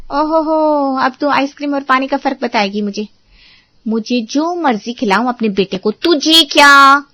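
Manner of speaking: sister-in-law sneers back in the quarrel; mocking scoff. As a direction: scoff and throw it back in her face.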